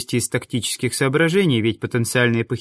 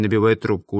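ordinary delivery, nothing marked

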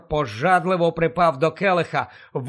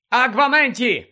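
shouted spell; 6 semitones higher, 4 dB louder than usual. Acted. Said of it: "крикнув він".

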